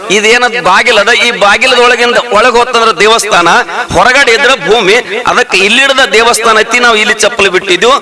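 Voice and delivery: high, ringing sermon voice; fervent village preacher; preaching at full force. Acted. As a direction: preach it out to the crowd.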